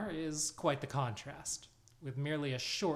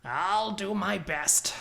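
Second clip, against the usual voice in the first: higher and slower, a drawn-out promise; slow, high character voice; 6 semitones higher, 8 dB louder, slower than usual. Acted. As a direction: deliver it in a slow, lifted voice. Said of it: "says Ra".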